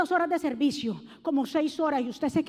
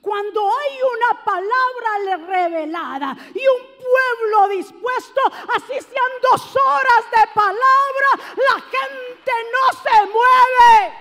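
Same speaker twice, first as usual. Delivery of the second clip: quoting a famous preacher, rising to a full shout; preacher's shout, building; 7 semitones higher, 9 dB louder, slower than usual. Retold or imitated. Quoting a famous preacher to the church, a building shout.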